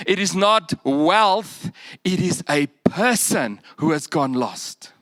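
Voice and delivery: usual speaking voice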